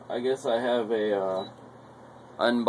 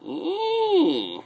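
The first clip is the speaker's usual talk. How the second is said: excited squeal. Real, not acted. Squeal at a find.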